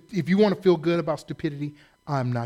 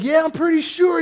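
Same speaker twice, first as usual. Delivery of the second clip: exasperated yes; 9 semitones higher, 8 dB louder than usual. Retold or imitated. Pastor answers, exasperated.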